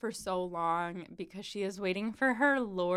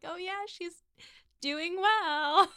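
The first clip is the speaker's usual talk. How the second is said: 1 awkward Miss Bates; 9 semitones higher, much slower than usual. Retold or imitated.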